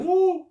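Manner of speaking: squeaky, startled high ooh; a sudden falsetto ooh; high-pitched ooh. Retold or imitated. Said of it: "you see a fat guy in a bathtub going".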